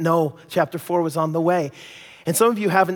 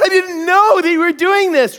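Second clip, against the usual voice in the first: tearful wife; 14 semitones higher, 8 dB louder than usual. Retold or imitated. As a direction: plead it like the wife. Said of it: "She said".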